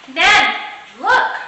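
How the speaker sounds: put-on high, loud child call; shouting. A narrator's reading. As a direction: call out across the yard. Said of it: "I shouted".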